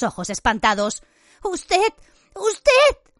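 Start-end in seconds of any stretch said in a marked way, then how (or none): 1.4-1.9 higher, choked first usted; usted said high but held back; stunned accusation. A narrator's reading. Hermione accusing Lupin. 2.3-3.1 usted much higher and louder; usted flung out as a cry; shrill accusation. A narrator's reading. Hermione accusing Lupin.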